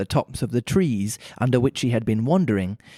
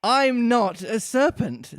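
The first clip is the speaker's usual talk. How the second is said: indignant Alice; 8 semitones higher, slower than usual. A narrator's reading. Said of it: "said Alice indignantly".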